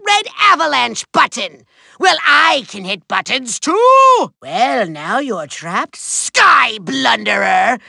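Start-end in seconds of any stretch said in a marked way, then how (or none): 4.4-7.8 low, slow savoring taunt; menacing gloat. Acted. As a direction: draw it out over a cornered foe. Villain gloats over trapped foe.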